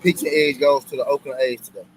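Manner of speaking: announcer-style pick. Real, not acted.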